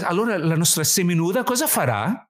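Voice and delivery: earnest Italian question from the producer; Italian original. Retold or imitated. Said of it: "she goes".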